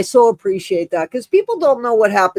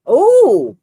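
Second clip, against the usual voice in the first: sudden high oh of surprise; a startled, bright exclamation; surprised oh; 8 semitones higher, 5 dB louder than usual. Real, not acted. Host catching a viewer comment, surprised oh.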